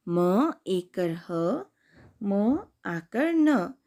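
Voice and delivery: slow, stretched letter names; slow spelling. Shown as a demonstration. Teacher repeating the spelling for kids. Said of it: "अब दूसरा शब्द है".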